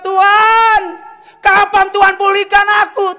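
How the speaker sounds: high, loud imploring shout; crying out. Retold or imitated. Preacher imitating a believer begging God for restoration, loud cry. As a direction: yell the plea up to heaven. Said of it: "Ketika engkau teriak".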